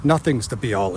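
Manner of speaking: ordinary delivery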